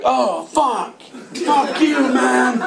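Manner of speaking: high, laughing yell of disbelief; laughing shout. Retold or imitated. Friends see the hidden weed box, shouting and laughing. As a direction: holler it through a laugh.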